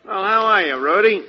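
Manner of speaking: booming, high, loud hello; hearty greeting. Acted. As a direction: greet him like a long-lost pal. Archie bursts in greeting Rudy.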